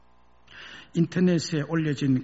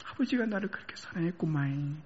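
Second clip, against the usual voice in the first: mild tearful Jeolla cry; 9 semitones higher than usual. Acted. Son weeping all night, tearful Jeolla dialect. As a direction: wail in Jeolla dialect through tears. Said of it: "통곡하며 울었답니다".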